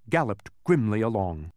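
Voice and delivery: usual speaking voice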